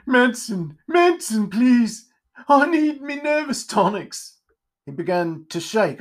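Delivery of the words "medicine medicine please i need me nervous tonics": high, strained begging voice; whiny, panicky plea for help; frantic pleading Pumpkinhead. Acted. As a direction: plead desperately in a high character voice. Frantic pumpkinhead begging for his tonic.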